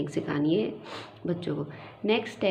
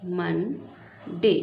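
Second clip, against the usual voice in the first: Monday stretched out very slowly; slow model word for a child; much slower than usual. Shown as a demonstration. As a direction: draw Monday out, syllable by syllable. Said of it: "Monday blend नहीं होता".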